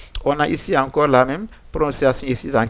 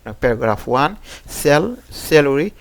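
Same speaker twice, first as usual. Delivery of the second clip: ordinary talk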